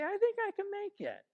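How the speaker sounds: mock cheerful self-talk; upbeat resolve. Shown as a demonstration. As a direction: speak like you just chose to be positive. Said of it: "You say".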